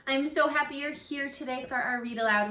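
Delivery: ordinary talk, nothing marked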